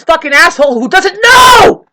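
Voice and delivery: high, loud outburst building to a yell; angry shout. Real, not acted. Ranting about a neighbor, furious rising shout. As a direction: shout it, blasting the final word. Rant erupts into insult.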